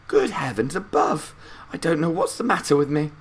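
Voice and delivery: put-on high, quick, flustered old man; Grandpa Joe. A narrator's reading. Grandpa Joe fussing at his own forgetfulness, quick high old man's voice. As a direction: scold himself like a muddled grandpa.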